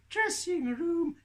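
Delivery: very high, stretched-out squeak; sing-out slowly in a tiny puppet voice; puppet Tilly. Acted. Puppet insists on the nicer name, high slow voice. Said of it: "You used the wrong voice".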